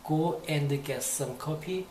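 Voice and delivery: slow, careful polite request. Shown as a demonstration. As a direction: ask very politely, drawing every word out. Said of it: "아주 정중하게 하자면".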